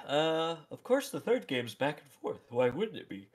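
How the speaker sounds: talking through a yawn; yawning while speaking. Real, not acted. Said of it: "I'm yawning like crazy".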